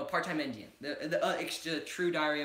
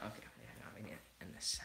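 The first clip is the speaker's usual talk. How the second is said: whisper; 9 semitones lower, 16 dB quieter, faster than usual. Real, not acted. Aside to cohost off mic.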